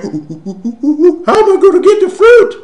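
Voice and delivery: loud, high-pitched excited monkey hoots; over-the-top monkey voice, loud and fast; monkey brain. Acted. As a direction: hoot and holler like a frantic monkey. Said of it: "My monkey brain starts going".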